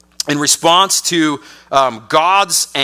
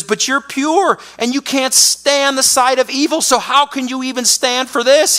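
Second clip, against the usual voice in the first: much higher, challenging question; indignant prophet; 8 semitones higher than usual. Retold or imitated. Habakkuk challenging God, pitch raised high. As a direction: demand an answer from God. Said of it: "he says".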